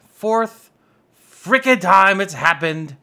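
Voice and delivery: loud, exasperated complaint; fed-up outburst, slow; frustrated. Real, not acted. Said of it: "I've been so upset".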